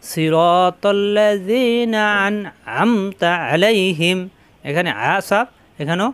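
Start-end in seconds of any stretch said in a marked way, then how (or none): 0.1-4.2 slow, drawn-out chanted recitation; Quran recitation. Shown as a demonstration. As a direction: stretch each long vowel like tilawat. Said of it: "teacher recites the phrase as model".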